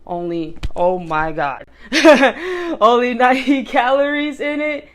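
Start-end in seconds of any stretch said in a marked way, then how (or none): 1.7-2.8 sudden high delighted laugh; burst of laughter. Real, not acted. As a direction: laugh out loud, pleasantly surprised. Laughs at the low calorie count.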